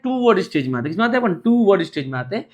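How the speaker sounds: ordinary talk, nothing marked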